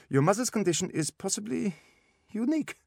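put-on high-pitched German-accented diagnosis; German specialist, pitched well up; German doctor. A narrator's reading. German doctor gives blunt diagnosis.

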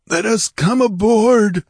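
high, loud, slow plea; croaking rogue. A narrator's reading. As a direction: plead as a croaking pirate. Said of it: "croaked a hairy rogue".

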